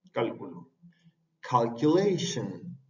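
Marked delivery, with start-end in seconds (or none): 1.4-2.8 "Calculation" drawn out, kal-ku-LAY-shen; slow model word. Shown as a demonstration. Teacher drilling the word slowly, "Calculation". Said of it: "como calculation".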